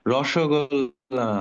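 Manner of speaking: রসগোল্লা said very slowly, syllable by syllable; slow model word. Shown as a demonstration. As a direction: spell out রসগোল্লা slowly for the class. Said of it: "উচ্চারণটা শুনবা". (ধীরে ধীরে উচ্চারণ)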